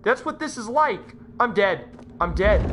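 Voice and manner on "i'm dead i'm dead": low, subdued dread; resigned dread. Real, not acted. Player facing death in game, low dread. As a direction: say it low, as if finished. Resigned before panic builds.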